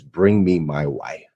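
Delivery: ordinary delivery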